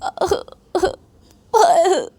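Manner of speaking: very high, exaggerated ouch of pretended hurt; fake pain cry. Ananya falls off on purpose and fakes pain to Payal. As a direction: ham up a fake back injury. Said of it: "जानबूझ के bed से गिर जाती है".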